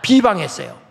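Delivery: usual speaking voice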